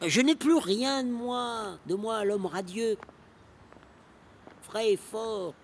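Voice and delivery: high, drawn-out outcry of loss; anguished lament. Acted. Old poet mourning his lost youth, anguished lament. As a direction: cry out in grief for the man you were.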